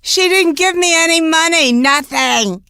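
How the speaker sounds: mock complaint. Real, not acted.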